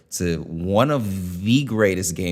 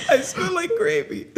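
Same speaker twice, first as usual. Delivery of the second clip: high, giggling through the line; quoting Bridget through laughter; laughing quote. Retold or imitated. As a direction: crack up while quoting. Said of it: "she was like".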